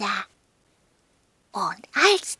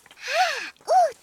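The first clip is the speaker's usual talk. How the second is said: higher, quick, excited dog voice; 12 semitones higher than usual. Acted. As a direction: squeak it out, pleased. Dog pleased with the note.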